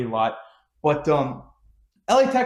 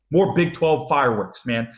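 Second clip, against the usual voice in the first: ordinary delivery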